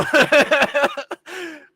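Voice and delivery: high burst of laughter; loud laugh. Real, not acted. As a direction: crack up at your own joke. Laughs at own bit.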